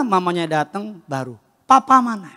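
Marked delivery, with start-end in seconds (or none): 1.7-2.3 put-on child seeking papa. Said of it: "Dia cari papanya".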